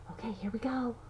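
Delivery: hushed whisper before the surprise; whispered suspense for the little one. Pretend. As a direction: breathe it like a secret. Building suspense for child.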